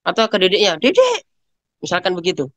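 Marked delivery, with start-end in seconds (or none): 0.8-1.3 high calling shout of a younger sibling's name; child yelling. Retold or imitated. Parent imitating a child calling the younger sibling, high yell. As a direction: call out to the little one, high and sharp. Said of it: "teriak-teriak".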